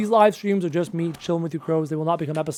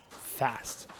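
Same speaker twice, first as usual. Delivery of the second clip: whispered echo; 7 semitones lower, 16 dB quieter than usual. Real, not acted.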